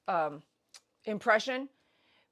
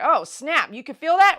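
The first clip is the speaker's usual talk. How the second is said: loud, rising disbelief; mock alarmed dentist; 6 semitones higher, 9 dB louder than usual. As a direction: act out an exaggerated startled reaction. Voices the dentist's overreaction.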